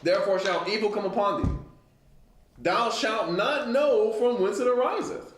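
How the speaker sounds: a preacher reading judgment from the pulpit; scripture reading. Acted. Reads scripture aloud.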